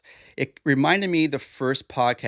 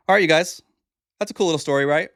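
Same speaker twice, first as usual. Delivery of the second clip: louder and higher, bouncing back into chat; upbeat switch; 5 semitones higher, 5 dB louder, much faster than usual. Real, not acted. Host turns brightly to his listeners after the letter. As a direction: snap back to cheerful host energy.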